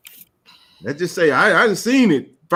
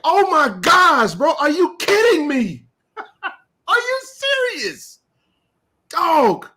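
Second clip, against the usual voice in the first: high, slow, half-laughing cry of disbelief; exasperated disbelief; 9 semitones higher, slower than usual. Real, not acted. Host to the rival platform, slow laughing disbelief. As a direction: sound like you cannot believe what you hear. Incredulous outburst mid-rant.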